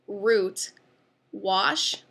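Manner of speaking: usual speaking voice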